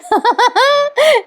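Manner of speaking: high-pitched burst of laughter; real high giggling laugh. Real, not acted. Storyteller laughs at her recap.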